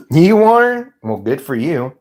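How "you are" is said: high, loud surprised question; really, you are?. Real, not acted. Reacting to a chatter who is high, surprised.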